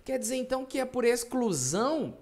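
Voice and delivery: teacher imitates a student's question; mock student. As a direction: play the student checking the rule.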